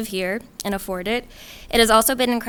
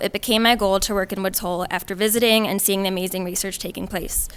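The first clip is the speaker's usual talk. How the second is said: ordinary talk, nothing marked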